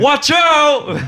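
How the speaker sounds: high, drawn-out comic holler; hammed-up high yell; mock warning shout. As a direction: shout a pretend climax warning. Pretend climax warning, shouted.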